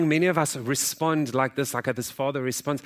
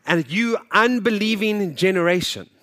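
rebuke; 5 semitones higher, 5 dB louder than usual. Acted. Jesus rebuking generation.

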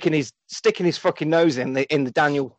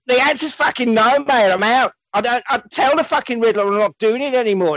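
mock angry refusal; 7 semitones higher than usual. Gary refusing the case.

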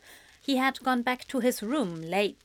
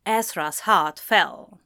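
usual speaking voice

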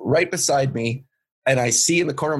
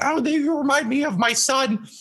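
high-pitched imitation of somebody's mom; doting older lady; 11 semitones higher than usual. Ladies in the front row about the comedian, doting. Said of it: "some of the ladies in the front".

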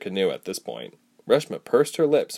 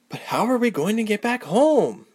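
protesting; 13 semitones higher than usual. A narrator's reading. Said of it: "Ilse protested".

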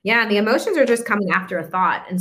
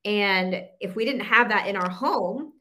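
normal talking voice

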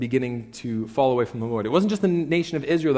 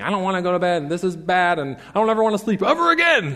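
put-on higher, louder childish whine; whiny toddler protest; 6 semitones higher, 5 dB louder, faster than usual. Preacher mimics a daughter fighting bedtime, whiny. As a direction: mimic a three-year-old refusing bed. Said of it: "fighting it and arguing, saying".

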